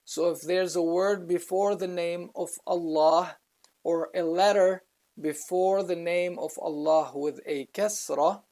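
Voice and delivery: ordinary delivery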